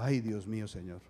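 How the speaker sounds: low, very soft plea to God; dismayed murmur. Retold or imitated. Patient sighing to God at bad news, dismayed murmur. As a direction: sink into a small, low murmur. Dismayed at months with one eye.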